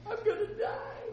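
very high comic wail; squeaky, exaggerated dread; mock despair. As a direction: whine it as if life is over, hammed up. Acting out everyday doom feeling.

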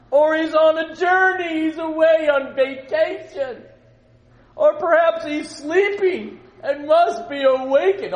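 high, loud, slow sarcastic taunts; mocking shout. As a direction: bellow the jibes to goad them. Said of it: "he was egging him on".

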